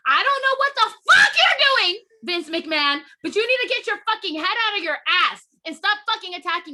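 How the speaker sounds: high-pitched shouted outburst; furious rant. Real, not acted. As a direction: yell it at full tilt, words tumbling out. Ranting in fury at McMahon.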